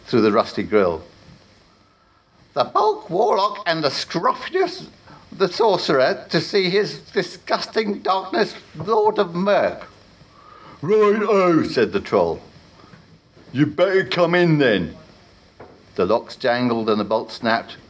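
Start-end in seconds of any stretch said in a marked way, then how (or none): 2.5-9.9 higher, slow grand announcing voice; pompous announcement. Acted. Warlock announces himself at door. 10.8-15.0 louder, higher gruff doorman voice; casual troll. Acted. Troll answers at door.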